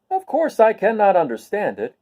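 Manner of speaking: a bright straw man who cannot understand; Scarecrow voice. Acted.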